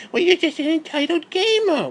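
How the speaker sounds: mocking critic. Mocking critics who call him entitled, in a whiny put-on voice. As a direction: mimic the smug critic. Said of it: "critics mocked in a voice".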